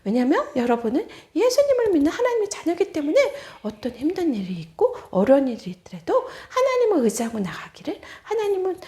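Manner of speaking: usual speaking voice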